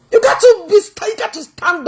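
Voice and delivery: exaggerated mock wail. Real, not acted. Taunting, exhorting the officer.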